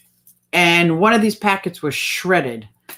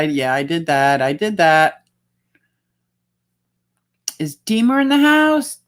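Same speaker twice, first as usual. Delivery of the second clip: ordinary talk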